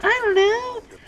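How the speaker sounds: put-on very high squeaky kid voice; little kids. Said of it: "they're like".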